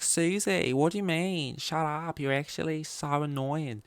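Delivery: high Australian soap retort; Australian soap friend snapping back at Susie; Australian soap accent. Acted. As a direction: toss it back like a soap star in Aussie twang. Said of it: "And they'll be like".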